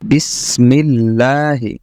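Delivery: slow, drawn-out recitation; Quran recitation. Shown as a demonstration. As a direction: recite with long held syllables for learners. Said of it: "আয়াত তিলাওয়াত করার চেষ্টা". (ধীরে টেনে তিলাওয়াত)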